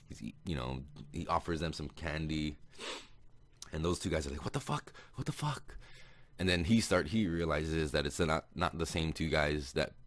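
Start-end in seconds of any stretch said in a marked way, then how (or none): 4.4-5.8 high-pitched startled mock disbelief; retold shock. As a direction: squeak it out high, imitating shocked men. Acting out two startled captors.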